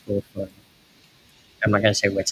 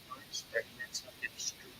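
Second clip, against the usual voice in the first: soft unvoiced whisper, much quieter; whispered aside; 3 semitones higher, 16 dB quieter than usual. Real, not acted. Streamer murmurs the time left to chat, whispered. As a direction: breathe it to yourself as an afterthought.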